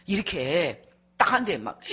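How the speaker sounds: normal talking voice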